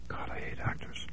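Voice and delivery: disgusted whisper; scornful breathy whisper. A narrator's reading. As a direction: hiss it barely voiced.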